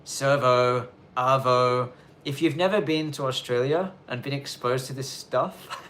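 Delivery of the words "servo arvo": Aussie slang words. Shown as a demonstration. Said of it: "you've got to expand on the words".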